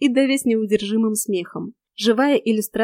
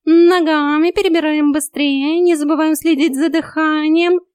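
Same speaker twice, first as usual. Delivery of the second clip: high, loud, drawn-out drill order; raised feline coach voice; bossy cat coach; 6 semitones higher, 6 dB louder than usual. A narrator's reading. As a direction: call the exercise like a trainer. Cat drills the chasing narrator.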